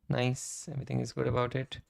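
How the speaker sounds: ordinary talk, nothing marked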